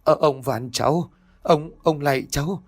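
high, faltering plea for mercy, voice breaking; broken pleading. Acted. Old man begging the ghost for mercy, broken terrified plea. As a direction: beg the ghost child in terror, words stumbling. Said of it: "Giọng của lão đứt quãng".

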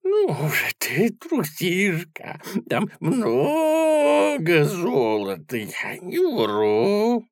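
high troll voice in a loud whisper. Acted. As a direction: coax in a loud creaky whisper. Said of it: "громко шептал".

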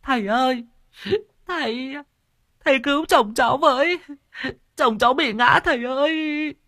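very high, wailing cry for help; a frantic village woman begging the master; high-pitched desperate wail; wailing wife. A narrator's reading. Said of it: "nhà Nở đã gào lên".

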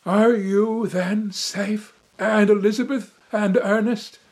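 a son begging his father for news, urgent; anxious cry. A narrator's reading. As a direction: reach out with the question, pleading. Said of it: "stretched out my hand to him and cried".

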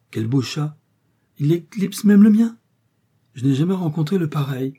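delighted exclamation. A narrator's reading. Young girl exclaiming over the handsome cat, thrilled. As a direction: burst out in admiration of the cat. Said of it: "s'écria Sophie".

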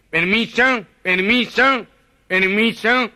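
high, pushing nasal-impaired plea through a crowd; 1 gangoso voice. Acted. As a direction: squeeze past calling permiso.

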